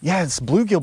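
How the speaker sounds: usual speaking voice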